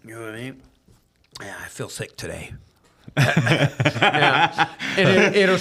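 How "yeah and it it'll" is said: higher and louder, laughing. Real, not acted. Joking about NyQuil with the host, laughing.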